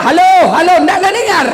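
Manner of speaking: loud, laughing "halo" into the wrong end; mock shout. Old haji yelling into a reversed phone, laughing mock shout. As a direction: yell it like a lost old man.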